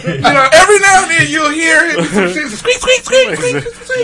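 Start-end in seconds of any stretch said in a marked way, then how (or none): 2.1-4.0 mock squeak noises. Imitating squeaking hospital noise.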